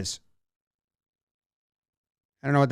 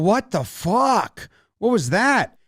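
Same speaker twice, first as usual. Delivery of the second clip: slow, drawn-out disbelief; imitated bewilderment; 4 semitones higher, slower than usual. Catcher turns to the dugout after a cross-up, imitated disbelief. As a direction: stretch it out, mock-incredulous. Rushing's reaction toward the dugout.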